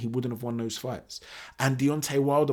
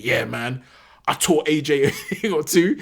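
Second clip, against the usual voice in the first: mock boast; 3 semitones higher, 7 dB louder than usual. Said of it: "delusions of grandeur".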